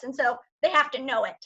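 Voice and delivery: ordinary delivery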